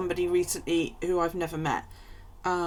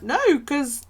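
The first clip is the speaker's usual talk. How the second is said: emphatic refusal; 9 semitones higher, 6 dB louder, slower than usual. Retold or imitated. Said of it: "And I'm like".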